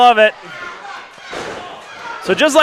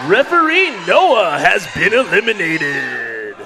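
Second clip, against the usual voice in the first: slow, drawn-out radio DJ boom; shock jock radio host; 4 semitones lower, slower than usual. Acted. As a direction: stretch it like an eighties DJ. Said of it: "shock jock eliminations".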